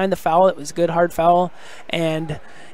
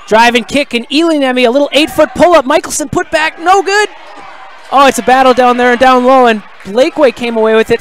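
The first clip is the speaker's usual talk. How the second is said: excited, raised basketball commentary; live play-by-play; 4 semitones higher, 4 dB louder than usual. Real, not acted. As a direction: call the action as it happens, pitch rising with the play.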